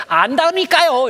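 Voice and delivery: very high, loud, drawn-out whine; impatient retort. Acted. Child cutting off the teacher, loud high whine. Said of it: "선생님 말씀도 막 잘라요".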